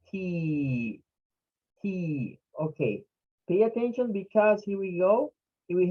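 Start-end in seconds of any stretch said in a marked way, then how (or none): 0.1-2.4 T with the E held long. Shown as a demonstration. Teacher modelling the last syllable, long E in T. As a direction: stretch the vowel in T. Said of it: "long sound for E".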